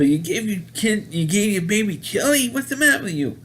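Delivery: high-pitched mock scolding; rising incredulous telling-off; TV repair woman. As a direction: imitate an appalled repairwoman, voice climbing. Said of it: "funny line from the T V repair woman".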